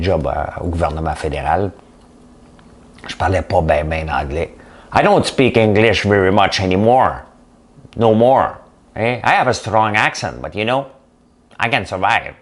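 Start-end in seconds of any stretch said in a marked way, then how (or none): 4.9-12.3 put-on Southern US accent in English. Showing off his English from Nashville, Southern US accent. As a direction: play up a thick Southern American accent. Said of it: "je sors mon accent, hein, du sud de, des États-Unis".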